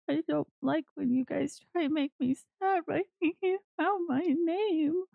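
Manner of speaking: high, slow crocodile-tear whine; tearful little kid. Acted. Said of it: "crocodile tears, starts sniffling".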